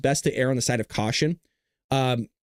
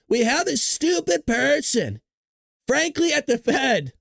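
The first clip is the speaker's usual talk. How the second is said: slow, higher-pitched mimicking of a politician's cadence; Donald Trump; 9 semitones higher, slower than usual. Streamer savoring the quote from President Trump, mock Trump voice. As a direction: draw it out, playful imitation from a higher pitch. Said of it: "I love the way he just talks".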